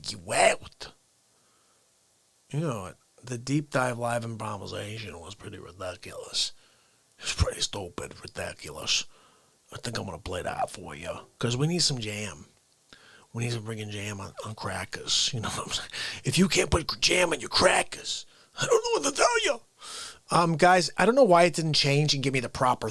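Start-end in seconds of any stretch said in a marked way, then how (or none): 3.2-15.9 hushed unvoiced aside; whisper. Real, not acted. Streamer whispering a jam plan to chat, whisper. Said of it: "whispering to chat". 18.6-19.6 very high, fast put-on squeak; How Dare You guy voice. Streamer dropping the meme voice on chat, high and fast. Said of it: "How Dare You guy".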